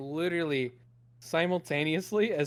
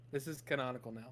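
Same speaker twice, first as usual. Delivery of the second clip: usual speaking voice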